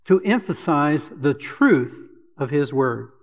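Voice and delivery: normal talking voice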